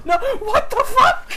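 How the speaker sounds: high, loud, laughing disbelief; shocked cry. Retold or imitated. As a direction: yelp as the throw misses. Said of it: "and he just goes".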